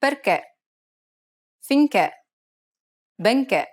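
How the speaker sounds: "Perché, Finché, Benché" with closed, stressed final E like "nay"; closed Italian E. Shown as a demonstration. Teacher contrasting the acute accented E, closed Italian E. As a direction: lift the jaw on the last E of "Perché, Finché, Benché". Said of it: "Compare that to the acute accented E".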